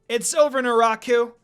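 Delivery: ringing triumphant shout. A narrator's reading. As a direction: call out the final blow. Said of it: "Kagome shouted".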